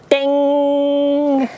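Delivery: high sung ding like a doorbell; buzzer ding. Retold or imitated. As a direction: chime out a bright ding. Imitating the little buzzer.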